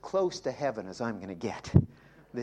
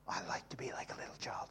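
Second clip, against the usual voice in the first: quick soft whisper; 3 semitones lower, 13 dB quieter, faster than usual. Real, not acted. Preacher confiding to the congregation in a whisper. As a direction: breathe it like a secret.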